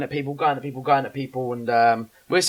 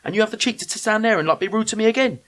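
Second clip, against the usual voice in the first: high-pitched indignant rant, retold; aggrieved tirade, acted out; losing his rag; 7 semitones higher than usual. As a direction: replay an outraged telling-off, piling up grievances. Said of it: "I just lost my rag".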